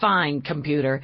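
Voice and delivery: plain reading voice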